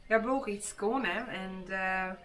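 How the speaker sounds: a lightly done Skåne dialect, slow and halting. Shown as a demonstration. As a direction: attempt a bad Skåne dialect with hesitations. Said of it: "hard to speak in this dialect".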